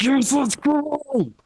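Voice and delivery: high, slow, stretched cheer; hyped students cheering on practice; excited teens. Retold or imitated. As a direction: cheer like kids who just got their wish. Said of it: "They're like".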